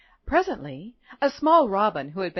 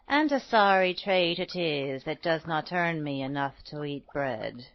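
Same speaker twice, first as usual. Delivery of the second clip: lightly voiced poor old woman, sinking lower; mumbling old woman; 5 semitones lower, 3 dB quieter than usual. A narrator's reading. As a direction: complain faintly like a weary old woman. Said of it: "mumbled the poor old woman".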